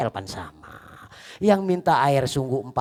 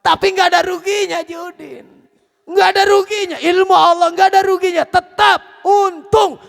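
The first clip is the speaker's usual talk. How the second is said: loud, high-pitched preaching cry; preaching at full force; 11 dB louder than usual. Acted. Preacher crying out to the congregation, full force. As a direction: hammer the point home to the congregation.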